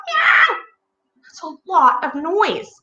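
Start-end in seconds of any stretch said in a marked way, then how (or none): 0.1-0.6 high, loud meow; piercing imitated meow; cat screech. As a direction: yowl like an upset cat. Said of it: "and the cat".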